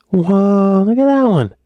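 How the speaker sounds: high, loud amazed whoa; thrilled at a photo of yarn art; amazed exclaim. Real, not acted. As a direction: burst out in wonder.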